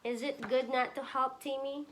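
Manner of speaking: ordinary delivery, nothing marked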